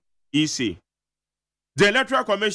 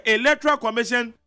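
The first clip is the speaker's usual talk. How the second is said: emphatic quote; 7 semitones higher than usual. Retold or imitated. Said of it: "Eti Hassan Ayarega na ɛka sɛ".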